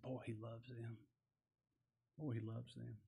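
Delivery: very soft, low murmur; quietly marvel, as an onlooker would; hushed awe. Retold or imitated. Onlookers' awed remark, voiced softly.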